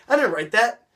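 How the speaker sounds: a startled squeak of denial; surprised denial. Retold or imitated. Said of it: "with a thing of surprise".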